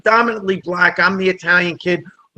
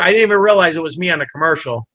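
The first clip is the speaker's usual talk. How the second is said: ordinary delivery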